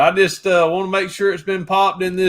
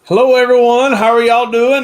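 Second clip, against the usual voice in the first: big, booming preacher hello; loud, rising hello to the live audience; loud greeting; 5 semitones higher, 6 dB louder than usual. Real, not acted. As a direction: project it out to the whole room, higher and louder. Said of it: "No, Rev is yelling".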